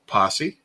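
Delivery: Posse said PAH-see, questioning lift; unsure pronunciation. Real, not acted. Host guessing at a show title, Posse as PAH-see. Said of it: "I don't know how the word is pronounced".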